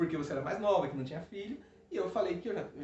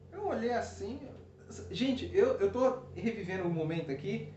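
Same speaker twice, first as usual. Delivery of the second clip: usual speaking voice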